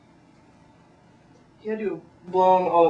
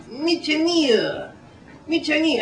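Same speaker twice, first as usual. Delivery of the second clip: high, loud mock accent; 9 semitones higher, 3 dB louder than usual. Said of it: "he goes, uh".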